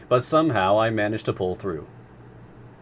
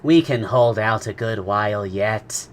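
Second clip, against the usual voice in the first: slow, even servant voice; a touch of placid stoicism; philosophical calm; 5 dB louder than usual. A narrator's reading. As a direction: reassure them unhurriedly.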